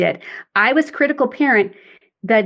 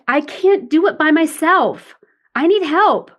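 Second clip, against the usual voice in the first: put-on high, pleading child's whine; a higher, slower helpless whine; adaptive child; 6 semitones higher, slower than usual. Shown as a demonstration. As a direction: beg for help like a helpless child. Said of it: "the adaptive child".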